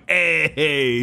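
hammed-up Italian "Hey"; Italian accent. Shown as a demonstration. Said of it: "my Italian accent, which is just me going".

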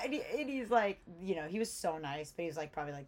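ordinary talk

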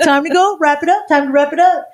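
high-pitched hustling friend; imitated wake-up call. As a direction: chivvy a sleeper along in a high voice. Imitates friend rousing her.

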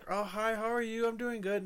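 high, sugary mock of fake politeness; high syrupy fake-nice voice; fake nice greeting. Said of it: "that fucking fake ass".

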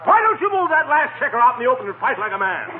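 goading challenge. Acted. Gildersleeve goading McGee over the checkers game, exasperated. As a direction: dare him to fight it out.

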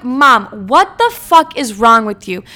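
loud, drawn-out exasperated demand; retold outrage. Daughter to mom over gluten-free advice, retold outrage.

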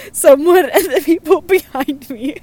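breaking into laughter mid-read; giggling through the words; laughing. Real, not acted. Laughing while reading submission.

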